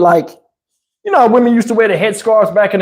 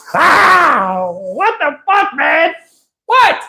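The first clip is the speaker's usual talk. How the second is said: high, drawn-out disbelieving cry; acted high-pitched shock; incredulous outburst; 8 semitones higher, much slower than usual. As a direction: stretch it out high in stunned disbelief. Reacting in disbelief to her remark.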